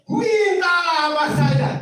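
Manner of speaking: high, slow preaching shout; ringing shout. Real, not acted. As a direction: call it out to the whole hall. Preacher calls out.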